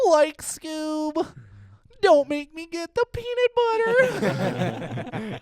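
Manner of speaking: high, slow Shaggy voice; Shaggy impression. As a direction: drawl it out like Shaggy. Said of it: "Your Shaggy".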